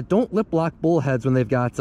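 ordinary talk

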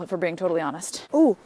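usual speaking voice

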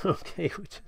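a real chuckle breaking into the word; amused laugh. Real, not acted. Artist tutor laughing at a cute character, amused chuckle. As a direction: laugh softly at how sweet the drawing is. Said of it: "I'm just laughing".

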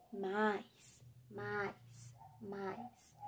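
low, softly fading repetition; hushed repeated word. A narrator's reading. Felipe after Sara's kiss, a low, fading murmur.

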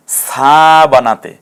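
সা held out long and slow; long vowel. Shown as a demonstration. Teacher shows students the stretched সা, slowly. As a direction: drag out the "aa" in সা. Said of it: "একটু টেনে".

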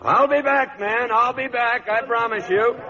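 high, fervent vow; earnest promise. Acted. Young officer promises the lady he will return. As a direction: swear you'll come back for her.

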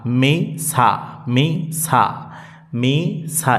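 syllables held out one by one; drawn-out two-syllable recitation; practice drill. Shown as a demonstration. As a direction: model each syllable for pupils to practise. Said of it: "এভাবে বেশি বেশি আপনাকে".

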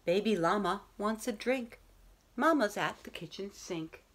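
lilting rhyming read-aloud voice; bedtime story reading. A narrator's reading. Teacher reading a bedtime story to her class, gentle and lilting. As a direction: read it like a picture book at bedtime.

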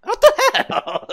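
high, slow stunned yell; shocked shout. Real, not acted. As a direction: cry out in disbelief. Character suddenly flips off edge.

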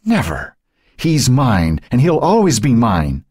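spew it at her sister; venomous fury. Acted. Dying Cynthia spewing fury at Joan. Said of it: "Fury and venom spewed".

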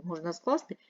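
normal talking voice

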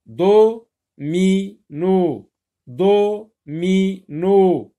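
"Do, mi, no" in separate loud beats; syllable by syllable. Shown as a demonstration. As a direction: sound out "Do, mi, no" with a pause after each. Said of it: "on n'entend pas le son en".

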